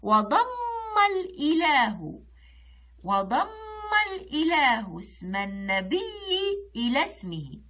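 slow verse with strongly leaned-on syllables; poem recitation. Shown as a demonstration. Teacher reading the next line, emphatic slow recitation. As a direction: recite slowly, holding doubled letters and long vowels. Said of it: "نقرأ السطر اللي بعده".

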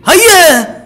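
high, loud triumphant yell; drawn-out loud victory cry; excited cheer. Real, not acted. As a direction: roar it as the level clears. Cheering as puzzle solves.

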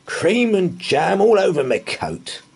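slow, high, bitter complaint; bitter man. A narrator's reading. Angry man complaining about his jam-covered coat, bitter. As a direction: grumble over the ruined coat. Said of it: "he said bitterly".

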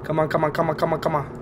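ordinary delivery

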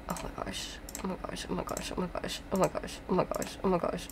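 very quiet, rapid muttering; barely voiced, hurried whisper; hushed panic. Real, not acted. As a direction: repeat it under your breath. Muttering repeatedly while hurrying in game.

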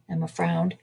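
ordinary delivery

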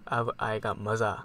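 low, soft and slow, savouring the kill; sinister gloat. Acted. Imposter gloating to viewers before the kill, low and slow. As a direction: purr it like a villain who has finally got his chance.

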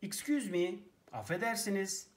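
ordinary talk, nothing marked

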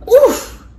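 sharp high grunt of landing hard; a jolted oof as he drops; pained oof. A narrator's reading. The boy crashing down into hidden rooms, pained oof. Fall through trapdoor.

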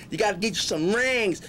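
ordinary talk, nothing marked

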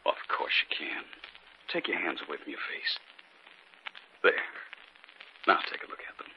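soft, hushed, soothing voice; husband quietly reassuring his wife; calm reassurance. Acted. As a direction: gently talk her down from fear. Husband calms frightened wife.

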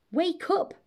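high-pitched wake-up call; crying out. A narrator's reading. Mabel cries out to wake the dragon, high call. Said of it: "Mabel cried".

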